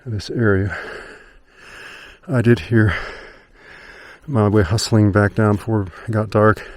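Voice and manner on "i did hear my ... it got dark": panting between words after a climb; breathless, winded from hiking uphill; out of breath. Real, not acted. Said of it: "Sorry, I'm so out of breath".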